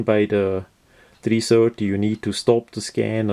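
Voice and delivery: ordinary talk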